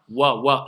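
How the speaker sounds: Wa with lips pushed round. Shown as a demonstration. Teacher showing how the lips move on Wa. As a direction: push the lips forward on Wa. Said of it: "je fais intervenir mes lèvres".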